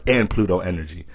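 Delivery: usual speaking voice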